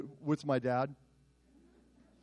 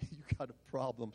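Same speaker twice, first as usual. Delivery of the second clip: much softer, lower replay of the challenge; quiet self-mocking echo; 4 semitones lower, 12 dB quieter than usual. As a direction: mutter it back at yourself, embarrassed. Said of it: "Stupid, stupid".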